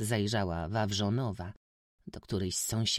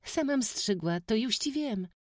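put-on proud village girl setting him right; eager peasant girl; 13 semitones higher than usual. A narrator's reading. Józka correcting the stranger, high girlish pride.

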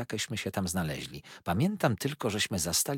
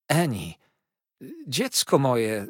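slow, louder pleading call; 6 semitones higher, 5 dB louder, slower than usual. A narrator's reading. Husband calls to his kneeling wife. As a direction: reach out to her gently but urgently. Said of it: "zawołał doktor".

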